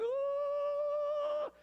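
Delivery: high, loud, startled cry of fright; terrified priest's sudden high shriek; startled yelp. Retold or imitated. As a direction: yelp out as if jolted by the angel. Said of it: "he was like".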